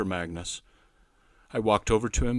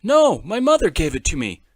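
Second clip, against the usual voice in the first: put-on loud, higher boy's voice insisting; 7 semitones higher, 12 dB louder than usual. A narrator's reading. Boy loudly insisting to his friend it is his. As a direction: dig in and deny it. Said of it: "he insisted".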